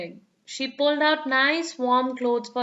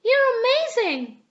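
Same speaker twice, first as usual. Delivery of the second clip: excited; 11 semitones higher, 3 dB louder than usual. Acted. Children cheering.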